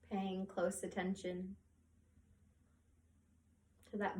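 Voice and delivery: soft, hushed meditation voice with long pauses; guided meditation. Acted. Yoga teacher guiding listeners to track their breath, soothing meditation voice.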